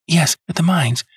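put-on high, eager plea from a devoted young woman; earnest young woman. A narrator's reading. Miss Turner begging Holmes to clear James, eager and high. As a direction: insist on his innocence, breathless with hope.

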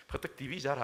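gruff, loud Gyeongsang order; Gyeongsang accent groom. Acted. Gyeongsang husband orders his bride to sleep, brusque Gyeongsang accent. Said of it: "경상도 남자가".

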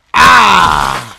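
long, loud "ah" cry; startled yell. Real, not acted. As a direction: yell out at a sudden crash. Cries out at game mishap.

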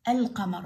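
القمر with a clearly sounded L; sounded lam. Shown as a demonstration. Teacher shows pupils a moon letter, lam sounded. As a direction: say القمر as "al-qamar". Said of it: "باعوا اللام اللفظة".

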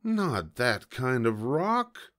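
slow, drawn-out exasperated groan; mild groan. A narrator's reading. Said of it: "I groaned".

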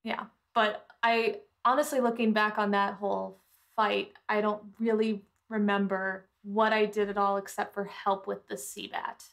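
normal talking voice

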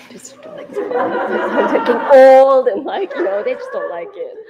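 laughing through the words. Real, not acted. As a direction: giggle while you say it. Laughing about sons hating poem.

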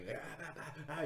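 high, faint imitation of far-off shouting; distant mock yelling. Gang shouting outside the bar, imitated high and faint. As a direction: mimic angry shouts heard from afar. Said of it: "still standing outside the bar just going".

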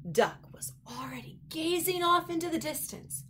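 whispered storytelling; hushed narration. A narrator's reading. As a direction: breathe the line in awe.

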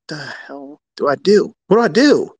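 flustered "what now" outburst, hammed up; mock bewildered panic. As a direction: act out a trader at a loss. Acting a panicking trader's inner voice.

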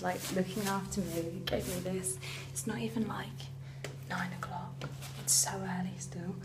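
soft unvoiced whisper, early morning. Real, not acted. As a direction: keep it to a breathy hush.

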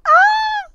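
high, loud shriek of surprise; startled shriek. Real, not acted. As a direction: let out a sudden squeal. Game event startles her.

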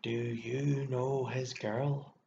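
slow, strained question with a slight tremor; slow, intense trembling voice; low, trembling. A narrator's reading. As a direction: draw it out slowly, trembling. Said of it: "in a low, trembling voice".

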